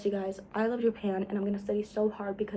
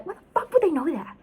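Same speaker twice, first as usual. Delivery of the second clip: high, louder exasperated rant; indignant; 9 semitones higher, 4 dB louder than usual. Real, not acted. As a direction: rant in disbelief, pushing up.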